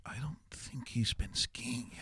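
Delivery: hushed gossip whisper. Retold or imitated. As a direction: whisper it behind his back. People gossiping about him.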